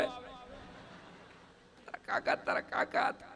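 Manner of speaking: whispered, drawn-out wail of a grieving widow; mock wailing. Widow wailing for her dead husband to the boy, hushed mock lament. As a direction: stretch the kaka into a hushed lament. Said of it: "રોતા રોતા કીધા".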